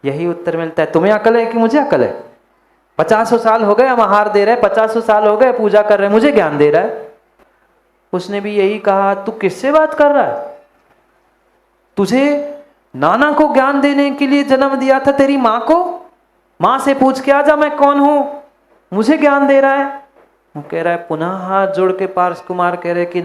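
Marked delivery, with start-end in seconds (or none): 0.9-7.1 fast, louder offended retort; mock-offended elder brushing off advice; indignant scolding. Elder scolds child giving advice. 9.1-20.1 high, loud, contemptuous scolding; enraged ascetic scolding the prince; furious rebuke. Acted. Ascetic rebukes young prince angrily.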